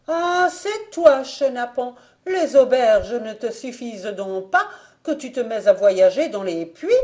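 high, loud indignant outburst; furious scolding. A narrator's reading. Fanta scolding the drunkard down the well, furious. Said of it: "Elle était furieuse".

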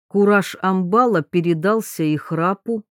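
ordinary delivery

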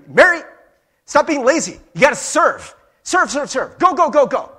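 high, loud drill-style orders; yelling. Acted. Imagined Jesus yelling at Mary to serve, acted shouting. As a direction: shout rapid-fire commands to get moving. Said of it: "Jesus yelling at Mary".

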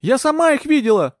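very high, forceful cry; high-pitched furious assertion; screamed insistence. A narrator's reading. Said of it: "закричала Маргарет".

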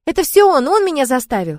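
put-on loud high childish cry; shouting. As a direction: blame him at the top of your voice. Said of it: "закричала".

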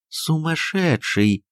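slow, drawn-out dread; fearful thought. Acted. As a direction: let the word stretch slowly in alarm. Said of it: "мелькнуло в мыслях Софьи Антоновны". (медленно, с ужасом про себя)

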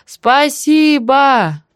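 high, very drawn-out yell; long, effortful high call; last-strength shout. A narrator's reading. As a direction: stretch it out with your last effort. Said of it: "крикнула из последних сил".

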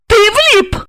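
high, indignant woman's retort; angry question. A narrator's reading. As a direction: throw it back angrily, pitched high. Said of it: "зло спросила". (зло, высоким голосом)

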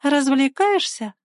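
high, drawn-out teasing question; mocking. A narrator's reading. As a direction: tease with a slow, rising lilt. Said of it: "раздался насмешливый голос".

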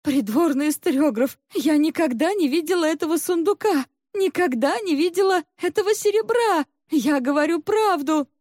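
high, imploring female voice; desperate pleading. A narrator's reading. Trembling maid begs the historiographer to believe her, imploring. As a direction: implore them, pitched high throughout. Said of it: "взмолилась".

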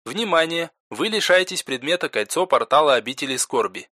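game system's announcer voice; system announcement. Acted. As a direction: announce it as a game's system message. Game system message pops up.

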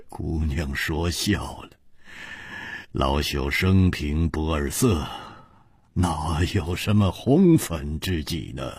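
put-on low, mild old gentleman; lightly voiced old man. A narrator's reading. Old man denying he has a lady confidante, low mild voice. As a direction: gently brush off teasing like an elder.

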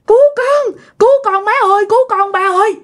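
high, pleading acted shout to parents; panicked cry for help. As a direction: beg your parents to save you. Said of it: "nghe ba la làng". (la lên cầu cứu)

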